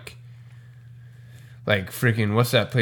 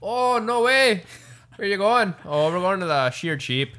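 higher, lively mock Irish pub chatter; mock Irish accent; 6 semitones higher than usual. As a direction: play a cheery Irish local at the pub. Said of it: "an Irish accent like that".

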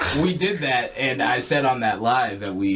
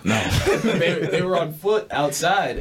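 normal talking voice

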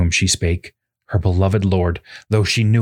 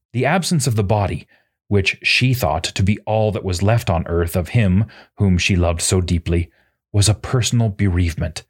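ordinary delivery, nothing marked

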